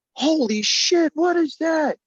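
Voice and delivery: high, slow panicked exclamation; drawn-out high cry of alarm; freaking out. Acted. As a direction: freak out at what you see. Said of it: "Jay kinda freaking out a little bit".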